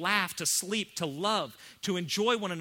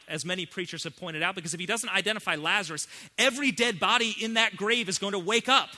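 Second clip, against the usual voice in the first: usual speaking voice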